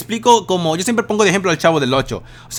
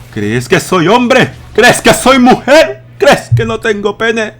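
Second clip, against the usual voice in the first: slow, loud, higher camp voice; silly goofy voice; 4 semitones higher, 7 dB louder, slower than usual. Acted. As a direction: ham it up absurdly. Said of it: "se me sale lo estúpido". (voz ridícula, lenta y aguda)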